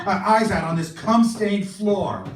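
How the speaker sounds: usual speaking voice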